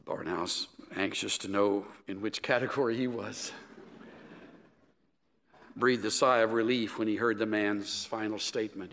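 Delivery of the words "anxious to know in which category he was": ordinary talk, nothing marked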